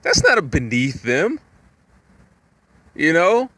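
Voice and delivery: usual speaking voice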